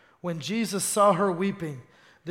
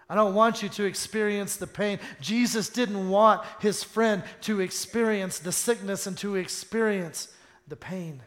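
ordinary talk

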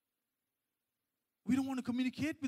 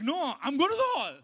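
defiant refusal; 5 semitones higher, 8 dB louder than usual. Acted. Defying the voice in the story.

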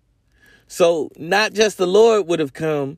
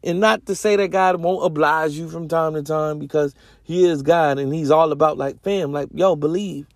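normal talking voice

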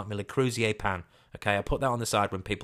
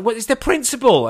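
indignant outburst; 11 dB louder than usual. Retold or imitated.

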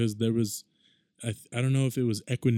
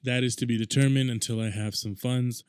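ordinary delivery

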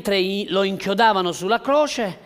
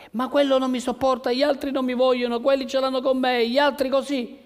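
fast, higher whiny list of grievances; mock complaining believer; 8 semitones higher, faster than usual. Pastor mimicking a self-pitying believer, fast and whiny. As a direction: rush the complaints like someone feeling rejected.

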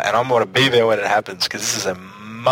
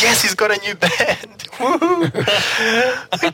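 excited; 11 semitones higher, much slower than usual. Retold or imitated. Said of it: "the first thing I thought was".

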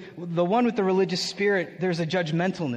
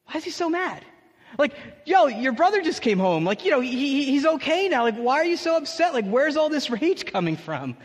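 higher, baffled questioning; incredulous questions; 7 semitones higher than usual. Acted. Pastor questioning the angry brother, higher and baffled. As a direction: ask it in disbelief, pitched up.

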